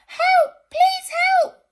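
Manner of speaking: very high, loud cry; crying for help. Acted. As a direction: shout for help in panic. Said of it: "cried Basil".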